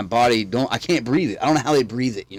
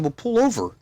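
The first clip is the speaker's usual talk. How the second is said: urgent plea; 11 semitones higher than usual. Retold or imitated. Urging panicking driver to stop.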